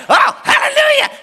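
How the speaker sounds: high, shouted hallelujah of an overjoyed bird; shrieked mock rejoicing; excited bird. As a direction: burst out in hammed-up jubilation. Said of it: "the bird gets excited".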